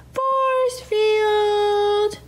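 very slow, high and loud; dramatic force field call. Acted. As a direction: stretch it out like a superhero move.

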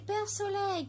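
high, repeated call to the sun; a mild echoing call to Père Soleil. A narrator's reading. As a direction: call again, reaching upward. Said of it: "cria Perlette".

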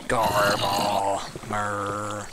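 low groaning undead gurgle; zombie noises. Acted. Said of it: "These are zombie noises I'm making".

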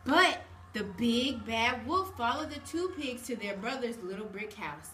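ordinary delivery

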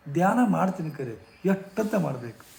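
imitating a frustrated old man; exasperated retort. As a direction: push back, tired of advice. Rich man retorting to guruji.

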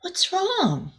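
high, worried question; concerned. A narrator's reading. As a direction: ask her kindly what's wrong. Friends comfort crying Rosella.

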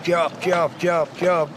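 drawn vowels into evenly chopped notes; bird song demo. Shown as a demonstration. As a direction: imitate a finch re-entering its song. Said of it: "Tekrar girerdi". (saka ötüşü taklidi)

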